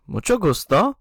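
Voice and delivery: "Mucho gusto" lifted and bright at the end; role-played dialogue answer; Spanish reply. Shown as a demonstration. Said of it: "And I'm gonna say".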